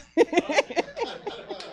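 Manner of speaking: high giggle tailing downward; amused laughter. Real, not acted. Speaker laughing after announcing the talk, high amused giggle. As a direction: giggle after your own remark.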